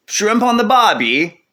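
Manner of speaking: hammed-up Aussie tourist voice; Australian accent. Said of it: "Your accent is really good".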